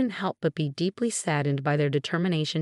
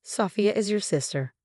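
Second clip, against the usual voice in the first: usual speaking voice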